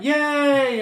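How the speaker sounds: high, drawn-out imitation cheer; mock cheer. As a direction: cheer like kids at a game. Acts out kids cheering at game.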